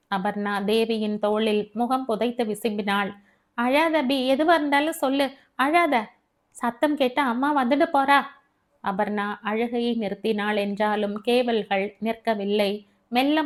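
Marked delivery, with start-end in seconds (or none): 3.6-8.2 high, urgent consoling voice; worried Devi. Acted. Devi consoles Abi before mother hears, high urgent voice. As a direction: plead with a friend to stop crying, pitched up.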